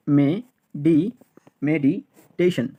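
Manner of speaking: Meditation built up slowly, me-di, medi-teshon; syllable by syllable. Shown as a demonstration. Teacher drilling learners, meditation built up in slow pieces.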